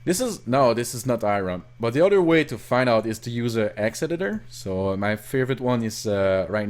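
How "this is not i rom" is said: ordinary delivery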